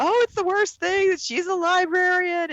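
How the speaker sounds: high, whiny mock-outraged voice, the last clause drawn out slowly; mocking outrage. Podcaster mocking Twitter users' outrage about the librarian, in a high whiny voice. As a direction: parody overdramatic Twitter complainers. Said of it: "they were like".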